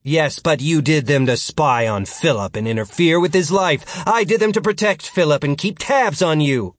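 loud, high, emphatic rebuttal; Martin, arguing back. Acted. Martin defends his spying to future Philip, arguing back.

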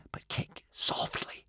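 slow, hushed warning whisper. A narrator's reading. Boy warning swimmers to kick softly, whispering. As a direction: whisper a careful caution. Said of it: "whispered Hiccup".

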